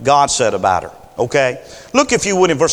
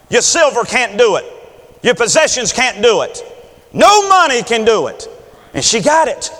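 preaching at full force; 5 semitones higher than usual. Acted. Preacher building toward a shout to the congregation. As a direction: drive it home like a revival preacher.

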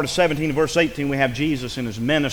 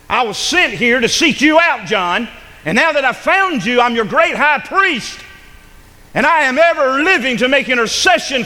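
high, loud, building sermon climax; preaching at full force; 8 semitones higher, 5 dB louder than usual. Acted. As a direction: lift the congregation, ring it out.

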